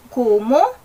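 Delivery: KUMO loud with hard k, rising sharply; wrong heavy K. Shown as a demonstration. Teacher shows a misreading, loud KUMO with hard k, rising. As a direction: push the k of KUMO and lift the end. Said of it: "Hindi siya".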